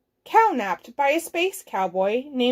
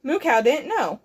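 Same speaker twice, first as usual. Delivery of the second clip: usual speaking voice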